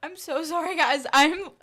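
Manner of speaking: fighting back real tears; emotional. Real, not acted. Apologizing to viewers while tearing up, teary. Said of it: "I told you I was emotional".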